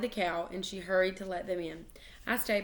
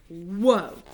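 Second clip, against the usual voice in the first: surprised whoa; 8 dB louder than usual. A narrator's reading. Sudden grab by Mother.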